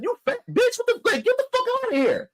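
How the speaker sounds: high-pitched angry cussing; high, heated retold cussing at the woman; cussing her out. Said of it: "So I'm cussing her out".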